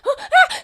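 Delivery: high startled laughing shriek; shriek at a rat. Acted. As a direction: squeal like you saw a rat. People scream at rat.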